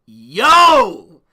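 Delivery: a sudden loud "Yo!" of delight; excited yell. Retold or imitated. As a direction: shout it out, thrilled. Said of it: "And I'm like".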